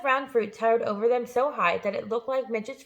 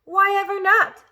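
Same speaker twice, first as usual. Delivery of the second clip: indignant aunt; 10 semitones higher, 8 dB louder than usual. A narrator's reading. Sister protests.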